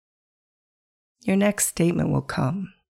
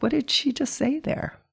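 confused listener; 9 semitones higher, 3 dB quieter than usual. Retold or imitated. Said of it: "I wonder if you're feeling like".

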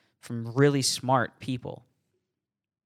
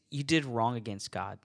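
ordinary talk, nothing marked

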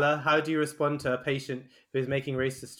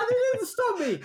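laughing. Real, not acted. Laughing retelling same blunder.

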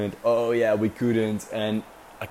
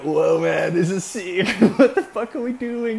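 higher, louder, laughing thrill; excited disbelief; 9 semitones higher, 5 dB louder than usual. Retold or imitated. Two mates after tying the dinghy in a storm, excited laughing. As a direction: say it buzzing with adrenaline. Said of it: "we were like".

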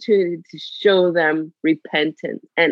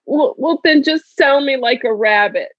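hurt son; 6 semitones higher, 3 dB louder than usual. Retold or imitated. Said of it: "he said to me".

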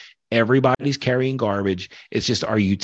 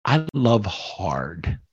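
getting emotional; much slower than usual. Real, not acted. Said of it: "and I'm getting emotional".

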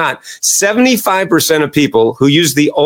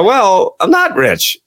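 high sheepish audience voice; a workshop attendee admitting it, high; audience member; 7 semitones higher, much slower than usual. Retold or imitated. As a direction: play the embarrassed listener, pitched up. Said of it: "And they go".

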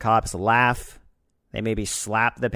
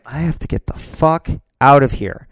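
desperate outburst; 5 semitones higher, 11 dB louder than usual. Retold or imitated. Deciding to escape his dad.

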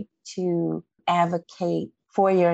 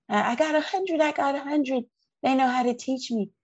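put-on clearly higher child voice, quick on the last line; imitating daughter; 8 semitones higher, faster than usual. Mother reliving her daughter's hundred on the exam, high child voice. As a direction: let the kid's pride spill out. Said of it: "she honored that because she".

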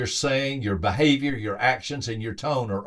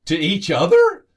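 higher and louder, amazed question; astonished; 7 semitones higher, 9 dB louder than usual. Retold or imitated. Couple hearing sixty years married, amazed blurted question. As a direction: blurt it out in disbelief.

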